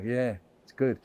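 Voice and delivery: a hint of a smug cat, murmured low; cats shrugging it off. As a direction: toss it away quietly like an unbothered cat. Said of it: "and they go".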